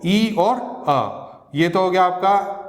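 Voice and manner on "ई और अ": ई और अ, each vowel drawn out very slowly; sounds pulled apart. Shown as a demonstration. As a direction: stretch ई then अ slowly like a teacher. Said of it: "इसमें दो sound है". (ई और अ को बहुत धीरे खींचकर बोलिए)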